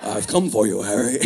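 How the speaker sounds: low villain voice breaking into a laugh; Voldemort voice, laughing. Pretend. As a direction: menace cracked by giggles. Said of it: "Voldemort out at night ... like".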